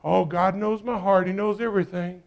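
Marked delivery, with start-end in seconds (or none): none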